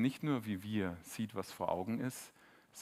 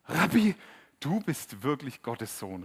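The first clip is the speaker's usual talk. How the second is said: awed exclamation; 3 semitones higher, 9 dB louder, slower than usual. Retold or imitated. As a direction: cry out in amazement, drawing it out. Nathanael bekennt Jesus staunend. (laut und staunend, Nathanael erkennt Jesus)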